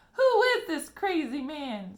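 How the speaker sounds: put-on high, loud, slow old-woman scream. A narrator's reading. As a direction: shriek at the stranger in outrage. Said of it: "screamed Grandma Josephine".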